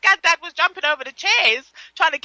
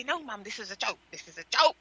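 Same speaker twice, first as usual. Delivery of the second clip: low, soft retold plea; pleading child; 6 semitones lower, 10 dB quieter than usual. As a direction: plead softly with Mum.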